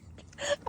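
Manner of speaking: quick airy giggle; short unvoiced laugh; light breathy laugh. Real, not acted. Laughs at the yawn.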